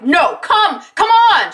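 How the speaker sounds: high, loud acted urging, rising to a yell; urgent pleading. Rebecca urging Jack to come, acted yelling. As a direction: imitate begging someone to escape. Voices wife urging husband to escape.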